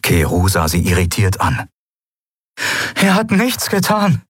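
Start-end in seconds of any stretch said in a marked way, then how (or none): 2.9-4.2 pleading. A narrator's reading. Endriel pleads for the pirate.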